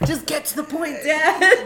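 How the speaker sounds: imitating a fed-up child; exasperated kid. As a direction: plead to cut the lecture short, half laughing. Kids' exasperated reply to dad acted out.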